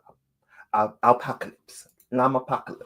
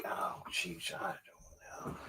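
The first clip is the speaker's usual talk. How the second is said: whisper; 5 semitones lower, 20 dB quieter than usual. Real, not acted. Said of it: "whispered aside".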